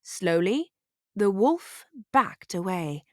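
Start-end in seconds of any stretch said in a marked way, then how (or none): none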